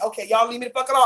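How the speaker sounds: ordinary delivery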